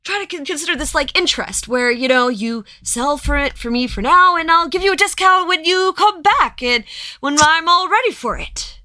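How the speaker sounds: high, loud, fast salesman pitch; pushy merchant. Acted. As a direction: sell it hard, voice up high. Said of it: "And he's like".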